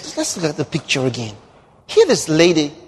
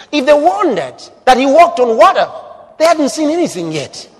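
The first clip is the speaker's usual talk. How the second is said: high, loud preacher's cry; preaching at full force; 7 semitones higher, 4 dB louder than usual. Real, not acted. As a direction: lift it into a ringing sermon shout.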